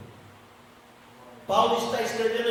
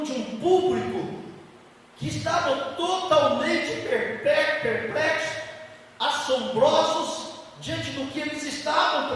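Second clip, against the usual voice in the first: high, raised preaching voice; emphatic preaching; 6 semitones higher, 5 dB louder, slower than usual. Acted. Teaching the church about spiritual gifts, emphatic preaching. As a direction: preach it out with punched key words.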